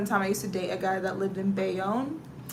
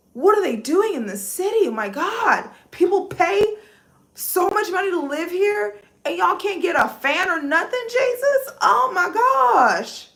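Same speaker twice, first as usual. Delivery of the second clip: high, loud, incredulous complaining; higher and louder, fed-up disbelief; exasperated rant; 8 semitones higher, 7 dB louder than usual. Retold or imitated. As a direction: rant at the heat like you can't believe it. Quoting her rant in hot station.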